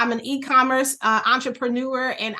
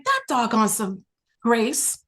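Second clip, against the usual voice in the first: slow, rising, louder marvel; slowing, climbing mock-exasperated praise; touched exclamation; 6 semitones higher, 4 dB louder, slower than usual. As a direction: exclaim in amazed gratitude. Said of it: "I was like".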